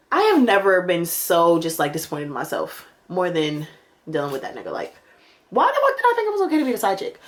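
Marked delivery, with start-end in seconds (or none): 5.5-7.2 high, fast self-scolding; disbelieving outburst. Real, not acted. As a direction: shake your head at yourself.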